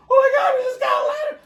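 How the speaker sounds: shrieking way up high, loud and fast; inner scream of joy. Retold or imitated. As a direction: scream it like a thrilled kid. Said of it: "inside your head".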